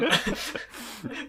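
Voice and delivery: breathy laugh. Real, not acted. Laughing at the accent.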